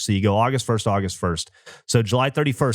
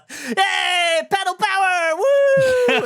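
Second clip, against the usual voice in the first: high, loud cheery yell from a passerby; excited shout; 3 dB louder, much slower than usual. Retold or imitated. Old cyclist cheering them on, high happy yell. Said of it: "he smiles and he goes".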